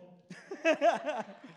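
loud, high burst of laughter. Real, not acted. Preacher laughing at his own story, loud laugh. Laughs after friend's told-you line.

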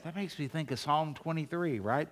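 ordinary talk

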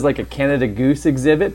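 usual speaking voice

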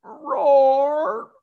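hammed-up weepy little lion; mock tearful roar. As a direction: roar like a lion through pretend sobs. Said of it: "he's still crying".

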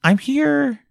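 high intimate ear voice; right in your ear. Pretend. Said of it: "in your ears".

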